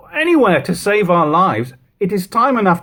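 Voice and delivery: urgent. Acted. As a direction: insist desperately to save their lives. Desperate brother in dialogue.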